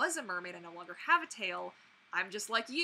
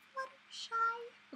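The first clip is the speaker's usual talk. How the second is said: high, slow, failed Fluttershy voice; a badly missed high pony voice; 14 semitones higher, much slower than usual. Shown as a demonstration. Vlogger tries her Fluttershy impression and flubs it. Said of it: "Okay, that was terrible".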